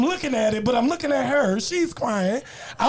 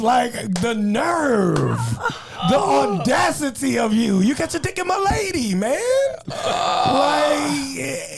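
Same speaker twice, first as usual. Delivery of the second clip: outraged; 6 semitones higher, slower than usual. Real, not acted. Outraged retelling of the cheat.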